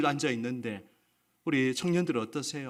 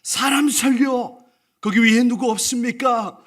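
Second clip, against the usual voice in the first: desperate shout for help; 9 semitones higher, 10 dB louder than usual. Acted. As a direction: cry out from the cliff for rescue. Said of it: "크게 소리를 질렀습니다". (절벽에 매달려 살려 달라고 외치는 절박한 고함)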